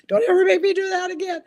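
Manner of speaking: high, loud mock protest; imitating reluctant husband. Wife quoting husband bamboozled into a baking show, mock complaint. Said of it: "falling asleep on the couch going".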